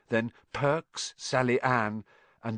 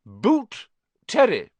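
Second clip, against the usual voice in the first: higher, louder stern teacher's call; McGonagall announcing; 10 semitones higher, 6 dB louder, slower than usual. A narrator's reading. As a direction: announce it crisply to the new students. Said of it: "McGonagall calls names".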